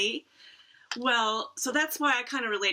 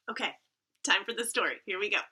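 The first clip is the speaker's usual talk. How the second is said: ordinary delivery, nothing marked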